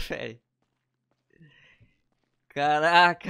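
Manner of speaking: ordinary talk, nothing marked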